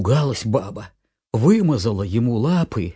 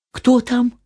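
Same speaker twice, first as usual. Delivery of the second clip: put-on kids' voices; 13 semitones higher, 5 dB louder than usual. Kids ask who's there.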